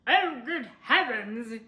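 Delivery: slow, loud, shocked gasp of words; gasped dismay. A narrator's reading. Mister Willoughby gasps at the bent tree, loud and slow. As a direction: blurt it out, aghast. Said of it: "he gasped".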